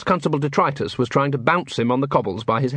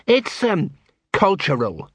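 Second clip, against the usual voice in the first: hesitant, mildly miserable reply; 4 semitones higher, slower than usual. A narrator's reading. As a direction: admit it haltingly and unhappily. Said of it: "said Sergeant Colon miserably".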